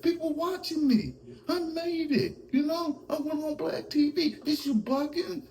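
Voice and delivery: very high-pitched, squeaky comic impersonation; Yayo voice. Acted. Host as Yayo complaining about Bleak, high squeaky impression. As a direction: whine it out high, mock-aggrieved. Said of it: "I'ma do the Yayo voice".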